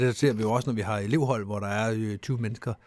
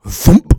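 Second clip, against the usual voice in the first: loud mock impact noise; imitating the jolt out of the plane; loud thump; 5 semitones higher, 15 dB louder than usual. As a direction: smack the sound out. Imitating being sucked out.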